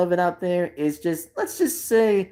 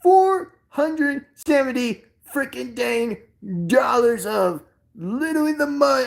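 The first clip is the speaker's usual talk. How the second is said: funny shocked impression; 7 semitones higher than usual. Streamer being funny about a toy's price, in mock shock. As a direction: draw out each word in comic disbelief. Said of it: "interesting impression".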